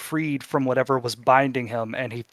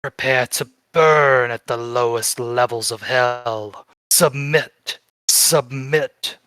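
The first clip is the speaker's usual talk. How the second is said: eerie cultist voice; 3 dB louder than usual. A narrator's reading. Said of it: "he says with three different voices".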